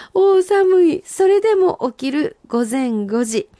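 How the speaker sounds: high, slow reading with a shiver in "おー、寒い"; 寒さに震えながら川柳を読む; shivering senryu recital. Acted. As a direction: act out the cold morning as you recite. Rereading senryu, acting cold.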